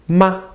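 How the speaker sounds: "ma" short, light and high; quick toneless "ma"; neutral tone. Shown as a demonstration. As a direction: say "ma" briefly, with no tone contour. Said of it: "This is called the neutral tone".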